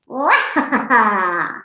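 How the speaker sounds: open-mouthed wah breaking into ha-ha; playful lion call with laughter. Shown as a demonstration. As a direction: demonstrate a laughing lion roar. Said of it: "looks and sounds like so".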